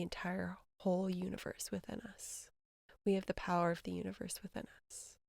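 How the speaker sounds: soft, hushed, near-whispered reflection on oneness; hushed spiritual musing. Acted. Speaking to viewers about oneness with the earth, in a hushed, soft voice.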